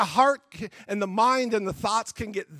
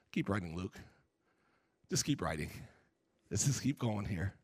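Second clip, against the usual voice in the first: much softer, lower, muttered under the breath; gruff aside; 14 semitones lower, 16 dB quieter than usual. Retold or imitated. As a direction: keep it hushed and low, brushing him off. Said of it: "I can hear Paul say".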